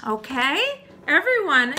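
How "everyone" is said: plain reading voice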